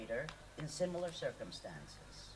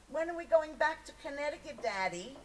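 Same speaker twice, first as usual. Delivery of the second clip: put-on higher, pleading child's voice asking Daddy; a young girl homesick for Connecticut; little daughter; 9 semitones higher, 3 dB louder than usual. Young daughter begging her father to go home, childlike pleading.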